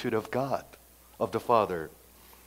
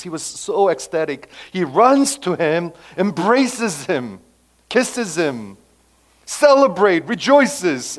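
louder, higher, swelling with joy; excited retelling; 5 semitones higher, 8 dB louder than usual. A narrator's reading. Preacher describing the father running to his son, excited. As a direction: tell it like the best news ever.

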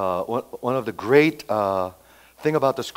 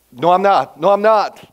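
high, loud, hurried-sounding retold denial; scared denial; 7 semitones higher, 11 dB louder than usual. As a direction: deny it in a panic, acting out the scared disciple. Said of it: "he was so scared that he goes".

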